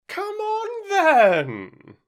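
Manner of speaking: very high, long-drawn bellow; roared command. A narrator's reading. Queen summoning Alice to croquet, high roar. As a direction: roar it out, stretching the last word. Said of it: "roared the Queen".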